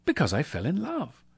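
high, lilting simple answer; sincere nephew. A narrator's reading. Nephew explaining his marriage, sincere.

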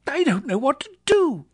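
high, loud giddy cry; overwhelmed with joy, between laughter and tears; laughing and crying. A narrator's reading. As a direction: cry it out high, beside yourself. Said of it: "laughing and crying in the same breath".

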